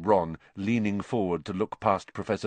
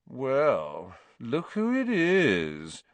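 slow, lazy, higher sneering drawl; 3 semitones higher, much slower than usual. A narrator's reading. Malfoy sneering at Harry in the doorway, lazy drawl. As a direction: stretch it out like you can't be bothered. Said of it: "said Malfoy in his usual lazy drawl".

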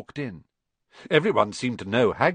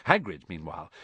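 ordinary delivery, nothing marked

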